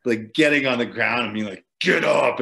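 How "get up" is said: growled command to himself; low demon voice. Retold or imitated. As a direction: bark it like a demon. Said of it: "in, like, a really low demon voice".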